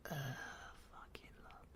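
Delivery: muttered scornful whisper; a distant, scornful whisper; disgusted whisper. Acted. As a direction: sneer the word under your breath. Scorn at the idea of love.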